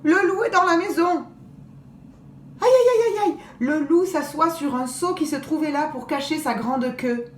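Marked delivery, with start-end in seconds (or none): 0.0-1.3 alarmed cry. Acted. Girls alarmed, wolf inside. 2.6-3.4 much higher, loud wailing aïe; loud dismayed aïe. A narrator's reading. Narrator lamenting the wolf's entry, loud dismay.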